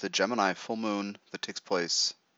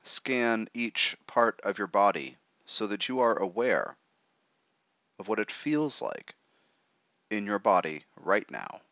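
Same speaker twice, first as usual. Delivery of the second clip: right in your ear. Acted. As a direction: talk them gently into relaxing. Leads a guided meditation.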